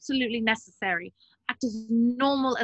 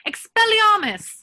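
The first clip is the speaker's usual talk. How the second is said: high, loud, drawn-out spell cry; spell shout; 10 semitones higher, 9 dB louder than usual. Acted. Hermione disarming Mundungus with a shouted spell. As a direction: fire the spell at him full voice.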